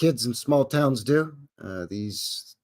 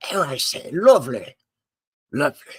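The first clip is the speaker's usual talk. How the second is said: put-on posh British accent; 3 semitones higher than usual.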